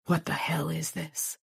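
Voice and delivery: slow, sickened whisper; hushed horrified whisper. A narrator's reading. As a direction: breathe it out, nauseated. Sick stunned cop at crime scene.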